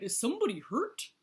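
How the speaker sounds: high, loud worried question; crying out. A narrator's reading. Hippo fears someone is hurt, crying out. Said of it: "he cried".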